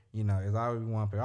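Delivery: usual speaking voice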